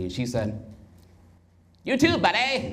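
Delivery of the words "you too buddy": put-on high, booming old-school football announcer call; NFL announcer. Wife answering his compliment like an NFL announcer. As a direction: call it like a retro NFL play-by-play. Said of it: "sound like an old school N F L announcer".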